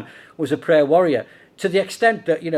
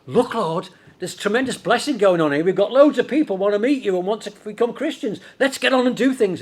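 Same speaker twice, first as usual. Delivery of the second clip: mildly excited, higher-pitched urging; lightly acted enthusiasm, building higher; eager disciples; 6 semitones higher, 4 dB louder than usual. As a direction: imitate keen followers pressing their leader to act.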